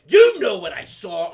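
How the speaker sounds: very loud, slow accusation; scolding bus driver. Retold or imitated. Bus driver confronting a student, loud scolding. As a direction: punch it out slowly and loudly.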